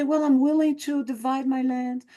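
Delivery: high, earnest mock offer; a hint of hammed-up sincerity; mock concession. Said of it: "who say".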